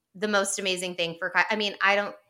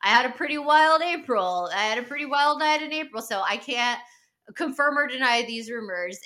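higher, louder put-on dodgy bro voice; mock evasive Kyle; 3 semitones higher, 4 dB louder than usual. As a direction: squirm out of the question like a caught bro. Mocking Kyle dodging the rumor.

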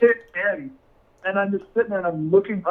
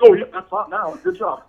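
sarcastic; 14 semitones higher, 3 dB louder than usual. Retold or imitated. Sarcastic praise of ruined pizza.

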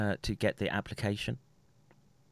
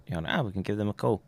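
usual speaking voice

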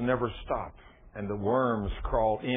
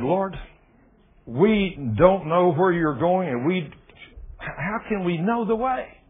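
raised, slow anxious plea; slow and raised, as a troubled follower pleading for the way; pleading question; 6 semitones higher, 6 dB louder than usual. Acted. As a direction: ask like a worried disciple, drawn out. Thomas questioning Jesus, pleading.

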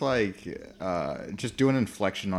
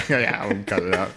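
ordinary delivery

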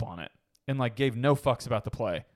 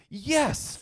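exasperated retort; 12 semitones higher than usual. Acted. Mock exasperated answer to the doubters.

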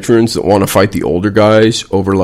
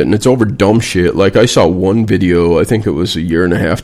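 usual speaking voice

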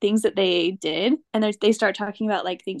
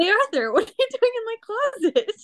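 put-on high, surprised kid voice, much higher; cartoon kid voice; 12 semitones higher than usual. Buster finding Arthur in his closet, high startled kid voice. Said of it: "and he's just like".

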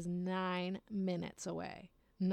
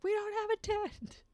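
much higher, louder incredulous snap; exasperated retort; 6 dB louder than usual. Retold or imitated. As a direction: fire it back high and disbelieving. Friend retorts to tent idea.

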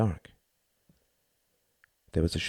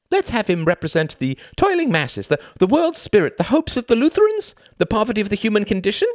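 high, louder and rushing faster toward the end; frantic listing; 5 dB louder than usual. Acted. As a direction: blurt out metaphors while running from the gun. Thin man fleeing the gunman.

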